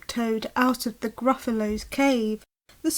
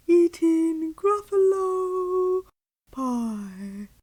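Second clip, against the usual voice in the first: slow owl voice; hooting owl; 5 semitones higher, much slower than usual. Acted. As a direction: hoot it out like an owl. Owl answers child.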